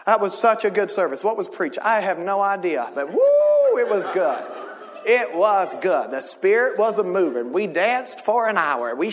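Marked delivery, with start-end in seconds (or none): 2.9-3.8 a sudden high falsetto woo; high whoop. Retold or imitated. Preacher recalling a great service with a high whoop. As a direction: whoop it up like church.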